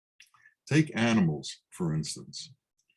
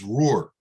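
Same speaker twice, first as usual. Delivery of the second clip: a louder mock roar; spoken roar; 5 dB louder than usual. A narrator's reading. Lion shows the sheep lion how to roar, mock roar. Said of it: "the other lion says".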